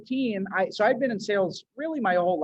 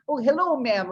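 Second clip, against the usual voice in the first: lightly faked Israeli accent, a bit higher; fake Israeli accent; 6 semitones higher, 5 dB louder than usual. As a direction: greet a shopper in a put-on Israeli accent. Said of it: "just faking this guy's accent".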